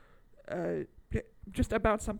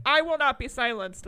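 loud, higher mock-defiant declaration; mock defiance; 5 semitones higher, 7 dB louder than usual. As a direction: proclaim it like a self-important activist.

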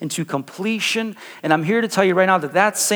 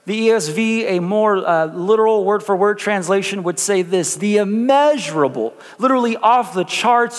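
higher, rising preaching voice; 4 semitones higher than usual. Acted. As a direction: build the message with fervor. Pastor preaching from translation.

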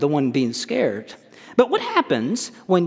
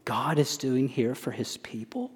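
hushed; 8 dB quieter than usual. Real, not acted. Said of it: "hushed emphasis in sermon".

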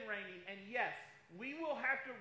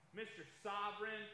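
normal talking voice

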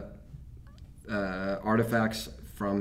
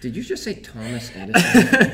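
laughing disbelief; 8 semitones higher, 6 dB louder, slower than usual. Real, not acted. Stunned at the silly answer.

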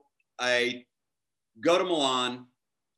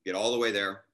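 ordinary talk

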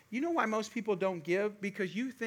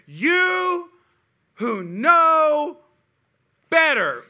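high, loud, drawn-out parent scold; 10 semitones higher, 12 dB louder, much slower than usual. Retold or imitated. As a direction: say it like every disappointed parent. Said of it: "You say".

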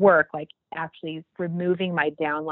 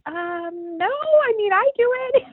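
very high, breezy I do it; sweet chirpy reply; bemused; 4 dB louder, slower than usual. Retold or imitated. Woman in blue explains oil, high chirp. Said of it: "she's like".

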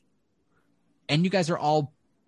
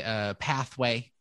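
ordinary talk

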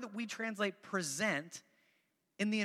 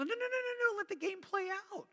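very high, rapid-fire no-no-no; head them off in a high mock plea; playful protest; 12 semitones higher than usual. Parent stopping kids peeking in Clue, high playful protest.

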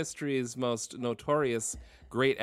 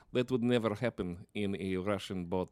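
put-on a loose improvised Russian accent, a bit low and soft; 5 semitones lower, 6 dB quieter than usual. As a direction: ham up a Russian sailor's accent on the fly. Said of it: "that's my shot at accent work".

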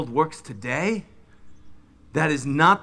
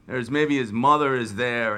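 normal talking voice

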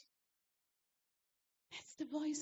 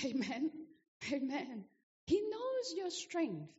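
ordinary talk

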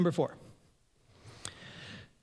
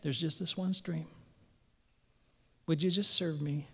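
much softer, lower plea; soft Jesus; 4 semitones lower, 9 dB quieter than usual. Retold or imitated. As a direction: invite them quietly, tenderly. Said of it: "Jesus is saying".

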